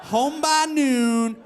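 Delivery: high, loud and slow, gloating; gleeful. Retold or imitated. Gloating to coworkers about going home, high slow cheer. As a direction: stretch it out like a victory chant. Celebrating the office shutdown.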